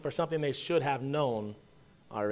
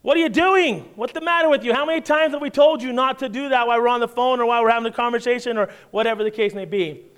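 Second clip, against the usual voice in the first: raised, exasperated parent scolding, fast at the end; scolding yell; 4 semitones higher than usual. Retold or imitated. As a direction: get after him like you just got after the dog. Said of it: "in the same voice that I just got after the dog".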